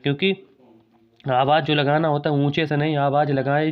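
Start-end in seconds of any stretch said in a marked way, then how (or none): none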